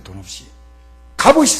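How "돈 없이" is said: soft echo. Real, not acted. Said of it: "quiet echo".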